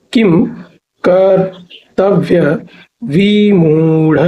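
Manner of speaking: very slow, drawn-out किम कर्तव्य विमूढ़, paused between parts; syllable by syllable. Shown as a demonstration. Teacher breaking a hard term down for students, syllable by syllable. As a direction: stretch किम, कर्तव्य, विमूढ़ apart slowly.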